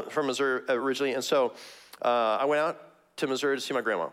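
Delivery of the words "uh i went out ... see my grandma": ordinary talk, nothing marked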